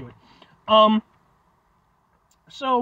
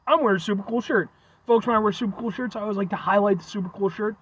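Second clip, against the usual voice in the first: usual speaking voice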